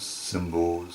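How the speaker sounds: ordinary talk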